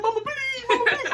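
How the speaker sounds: high-pitched mock wail for mama; imitated crying plea. Crying for mama on the inside, high mock wail. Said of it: "I was screaming. I was crying".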